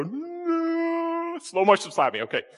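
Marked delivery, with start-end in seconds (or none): 0.0-1.4 high, hammed-up cry of no; mock wail. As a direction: fake a victim's wail, pitched way up. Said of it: "do I need to go".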